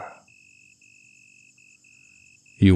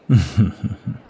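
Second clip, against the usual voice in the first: amused little chuckle. Acted. Amused lover's chuckle in roleplay.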